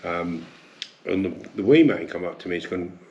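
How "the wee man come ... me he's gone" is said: ordinary delivery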